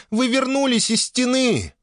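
very high, overjoyed cry; thrilled elf shouting; excited exclamation. Acted. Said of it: "воскликнула эльфийка".

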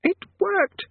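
very high, loud crow of delight; gleeful. Acted. Conjurer jigging with joy, high gleeful cry. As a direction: squeal with delight. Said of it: "did a mad little jig".

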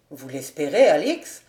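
understated bitter male question. A narrator's reading. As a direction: throw the question back, low and strong. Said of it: "dit amèrement le jeune homme".

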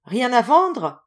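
loud, high call; exclaimed question. A narrator's reading. Visitor asking for wares, exclaimed question. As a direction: call it out like a trader. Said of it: "s'écria-t-il".

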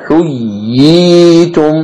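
حييتم recited very slowly, the ya firmly doubled and its long ī held; madd al-tamkin. Shown as a demonstration. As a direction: stretch the ī in حييتم after a clear double ya. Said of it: "بتشديد الأولى وتمكين المد".

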